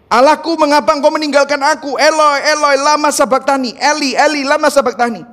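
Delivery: high-pitched cry of the forsaken; acted anguished crying out; shrieked plea. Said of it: "dua kata dengan kata menjerit".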